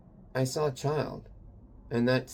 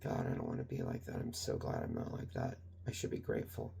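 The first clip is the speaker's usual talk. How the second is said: muttered to self; 14 dB quieter than usual. Retold or imitated. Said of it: "I walked away just like".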